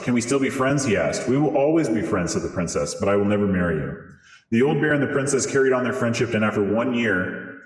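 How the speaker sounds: normal talking voice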